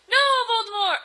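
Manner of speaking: high, loud melodramatic refusal; hammed-up cry; shouted. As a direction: shout it in mock defiance. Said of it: "I shouted".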